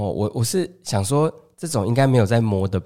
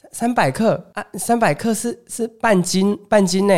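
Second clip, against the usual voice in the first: higher auntie voice, mildly incredulous; disbelieving auntie; 9 semitones higher, slower than usual. Retold or imitated. Auntie surprised by the tiny order, higher and mildly incredulous. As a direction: repeat the small amount in mild disbelief. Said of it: "然后她就说".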